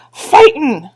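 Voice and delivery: high, loud war-cry; proud shout. Acted. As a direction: yell it like a boast to the chief. Feegles roar their boast.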